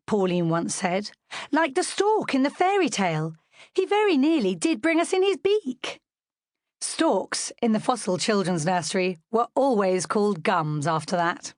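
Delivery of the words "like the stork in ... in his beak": lightly voiced young girl. A narrator's reading. Pauline speaking, voiced higher.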